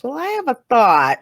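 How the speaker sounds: lightly voiced pompous thinker; imitating Richard's musing. Co-host mimicking Richard's big announcement, mock-ponderous. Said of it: "when he comes up with those".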